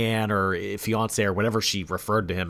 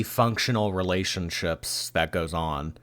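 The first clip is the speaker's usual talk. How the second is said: normal talking voice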